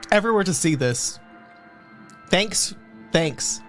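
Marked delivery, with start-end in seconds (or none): none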